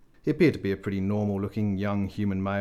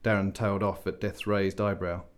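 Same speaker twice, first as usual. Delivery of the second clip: ordinary delivery, nothing marked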